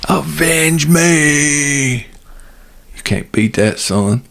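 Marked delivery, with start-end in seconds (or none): 0.1-1.9 slow, drawn-out dying cry; overacted death scene. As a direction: stretch it out like a dying father. Quoting Red Dawn movie line.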